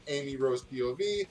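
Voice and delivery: ordinary talk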